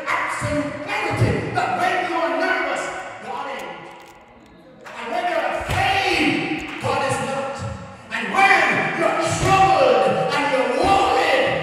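loud, high climactic preaching build; full-force preaching. Real, not acted. Preacher thundering to the congregation about fear. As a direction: preach it out, rising to a roar.

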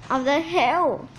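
ordinary delivery, nothing marked

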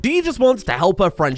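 ordinary talk

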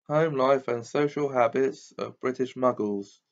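plain reading voice

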